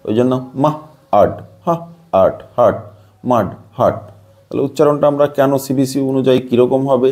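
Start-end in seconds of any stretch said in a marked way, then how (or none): 0.6-4.0 মাড and হাট broken into মাহ, আট, হা, আট with an "ah"; sounded-out pronunciation. Shown as a demonstration. Teacher showing that u says "ah", মাড হাট sounded out in pieces. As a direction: sound out each piece with pauses, then join as মাড হাট. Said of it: "ইউ এর উচ্চারণ আ হবে".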